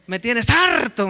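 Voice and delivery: slow, drawn-out "fed up" line; a touch of exasperation, hammed up slowly; mock fed-up wife. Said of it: "me dijo".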